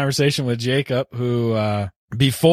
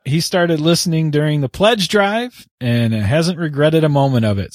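usual speaking voice

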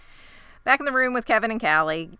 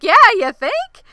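much higher, louder, biting sarcasm; sarcastic retort; 13 semitones higher, 12 dB louder than usual. Retold or imitated. Shauna snapping back at Jeff, loud sarcastic retort. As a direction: throw it back at him.

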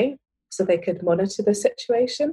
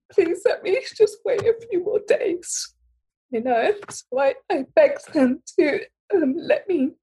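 fighting back real tears while pleading; getting emotional; 8 semitones higher than usual. Real, not acted. Retelling how she begged the doctors, voice breaking with emotion. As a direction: voice breaking up high on the plea. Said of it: "Sorry, I'm getting emotional".

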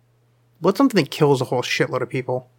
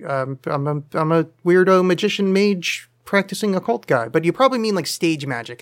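normal talking voice

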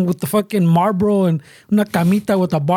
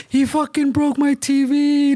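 put-on higher, slow, whiny little girl; mock crying girl; 7 semitones higher, slower than usual. Little girl crying over the broken TV, in a high, slow, mock-weepy voice.

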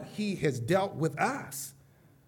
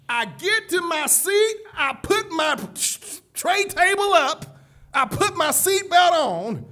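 loud, rising pulpit preaching; preaching at full force; 7 semitones higher, 7 dB louder than usual. Acted. Preacher lifting the congregation, fervent full-force preaching. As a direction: build the crowd up with each line.

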